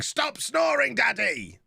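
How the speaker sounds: high-pitched imitated child's yell. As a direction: shout it like a fed-up kid. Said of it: "Andy spent all night yelling".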